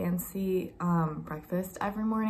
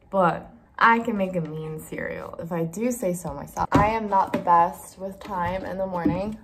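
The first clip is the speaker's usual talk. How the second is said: usual speaking voice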